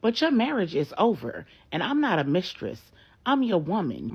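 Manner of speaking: high, loud angry protest; indignant. Acted. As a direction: fire it back, loud and high. Jessica argues back loudly.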